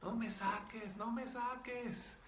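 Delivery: put-on very high, stretched child's plea, volume held back; little girl's yell. Spirit begs not to be removed, imitated little-girl cry. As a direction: mimic a screaming little girl in a thin-volume mock yell. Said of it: "Y gritaba durísimo".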